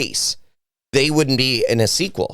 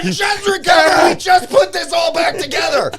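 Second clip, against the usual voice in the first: high, loud mock wail; anguished shout; 4 dB louder than usual. Real, not acted. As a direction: cry it out in despair. Lamenting Batfleck news, shouting.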